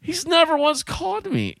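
high-pitched defensive objection; retold indignant protest. Retold argument over unpaid bill.

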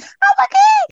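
re-enacted fangirl squeal, extremely high; starstruck, far above normal voice; sky-high pitch. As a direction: shoot up into an excited squeak. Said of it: "I was six octaves higher than [name]'s".

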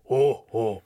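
deep slow booming chuckle; low rumbling alien laugh; Jabba laugh. Acted. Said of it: "first he laughs".

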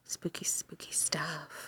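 hushed, breathy spooky whisper; mock-eerie whisper about the ritual. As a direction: whisper it like a ghost story.

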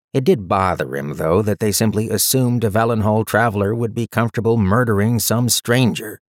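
ordinary delivery, nothing marked

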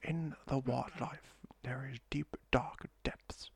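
slow, hushed nature-documentary narrator; David Attenborough. Acted. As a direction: half-whisper it with reverent, drawn-out weight. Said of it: "like a David Attenborough over the top".

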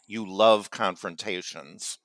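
ordinary talk

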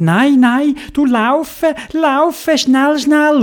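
high, alarmed urging; urgent pleading. Acted. Theobald urges shocked bear to run, high alarmed cries. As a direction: push the words out high, hurrying someone along.

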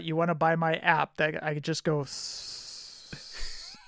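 slow, ending on a long snake hiss on s-; drawn-out s like a snake. Pretend. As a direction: stretch the final s- into a hiss. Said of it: "Jeff going s".

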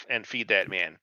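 ordinary talk

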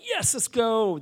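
high, eager retold cheer; excited. Buyers hear the offer was accepted, excited. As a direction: celebrate with a lifted, punchy voice.

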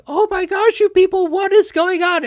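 high, loud, exasperated outburst; exasperated yell. Retold or imitated. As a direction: throw up your hands and yell at them. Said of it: "I'm like".

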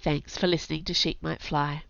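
usual speaking voice

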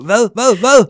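high, loud flustered repeats; imitating the jumper looking down in shock; mock panic. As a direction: sputter in hammed-up alarm. Retelling his own panic.

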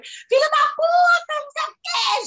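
high, loud yelled curse, pitch way up; shrieking at the doctor mid-procedure; retold screaming curse. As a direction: scream the insult out in pain. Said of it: "Gritei, xinguei o homem".

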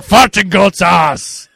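high, loud, slow mock movie yell; shouted actor imitation. Host corrects how the actor said the line, hammed-up shout. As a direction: belt the line out slowly, hammed-up. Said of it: "didn't say it with that accent".

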